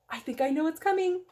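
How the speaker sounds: fast, eager knowing tone; can't wait to reveal what's next; excited guess. Pretend. Reader anticipates the twist.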